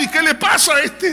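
reproche agudo, imitando a los familiares; reproche indignado. Retold or imitated. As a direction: imita a parientes escandalizados, con voz aguda. Relatives confront her over crying man. (pregunta indignada, en tono muy alto)

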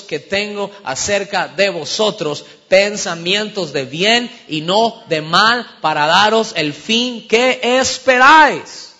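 loud, drawn-out scripture proclamation; preacher booming out a verse; full-force preaching. Real, not acted.

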